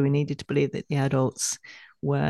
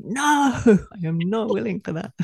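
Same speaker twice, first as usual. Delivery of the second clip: a loud, high "No" that melts into laughter; firm refusal; 6 semitones higher, 3 dB louder than usual. Retold or imitated. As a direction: brush off the thought, laughing. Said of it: "I go".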